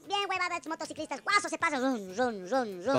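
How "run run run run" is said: low vroom-vroom noises; motorbike engine imitation. Caller describing reckless motorcyclists, imitated engine revs. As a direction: imitate bikes revving in a low voice.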